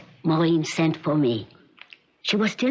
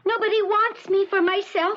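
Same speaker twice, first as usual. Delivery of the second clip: put-on high, small lost-girl voice; childlike voice. Withdrawn patient answering her visitor in a high childlike voice. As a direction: speak like a frightened little girl.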